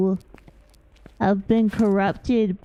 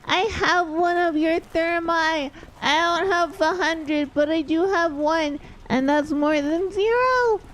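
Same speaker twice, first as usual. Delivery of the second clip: a hint of a chirpy character voice, a bit higher; lightly voiced game character; 6 semitones higher than usual. Streamer reading the game character's lines aloud, lightly voiced.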